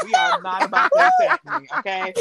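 squealing defensive objection; high-pitched protest. Real, not acted. Protests against being attacked.

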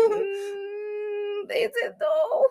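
high, soft, wary question; mock-nervous, hushed and high; spooked doubt. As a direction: squeak it quietly, unconvinced. Spooked parent doubts the child's voice.

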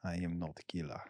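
put-on Russian accent; mock Russian accent. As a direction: imitate a Russian woman, low and quiet. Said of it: "I'm gonna say it in a Russian".

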